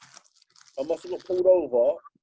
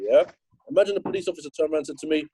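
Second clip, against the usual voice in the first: ordinary delivery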